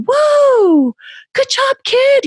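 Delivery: hammed-up over-the-top praise; mock amazed cheer. Imagined parent cheering a kid for eating broccoli, hammed-up amazement. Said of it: "it's not like things go".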